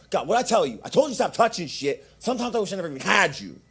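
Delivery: high-pitched imitation of a scolding mother; mock yelling woman. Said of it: "I hear this woman yell".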